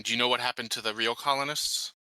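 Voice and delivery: ordinary talk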